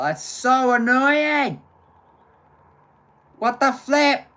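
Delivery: loud, high exasperated cry; frustrated outburst. Real, not acted. Streamer venting at the dead internet, loud exasperated cry. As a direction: vent the frustration loudly.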